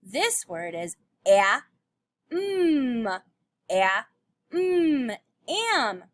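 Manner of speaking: "a, mm" sounded separately then blended to "am"; blending sounds. Shown as a demonstration. Teacher blending am for pupils. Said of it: "Trace it".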